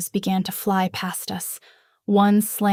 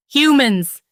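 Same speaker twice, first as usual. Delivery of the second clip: higher, loud roar; 6 semitones higher, 9 dB louder than usual. A narrator's reading. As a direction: roar the word at them. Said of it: "he roared".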